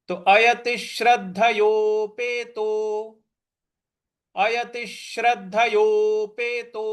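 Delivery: अयतिश्रद्धयोपेतो said slowly with stress on द; careful recitation. Shown as a demonstration. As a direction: model अयतिश्रद्धयोपेतो for pupils. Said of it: "इस प्रकार से बोलेंगे".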